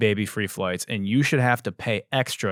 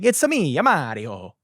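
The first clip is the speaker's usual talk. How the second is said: Mario impression; 5 semitones higher than usual. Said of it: "I've thought he's saying".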